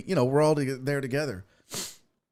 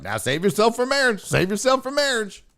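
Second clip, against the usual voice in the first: nagging parent; 7 semitones higher than usual. Retold or imitated.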